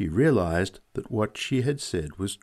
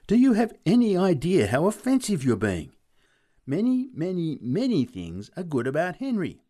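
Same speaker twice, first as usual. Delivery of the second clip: high, emphatic outburst; indignant cry; 10 semitones higher, 5 dB louder than usual. A narrator's reading. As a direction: scold him in a raised, high voice. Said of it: "she cried".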